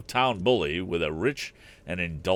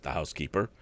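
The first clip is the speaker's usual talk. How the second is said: ordinary delivery, nothing marked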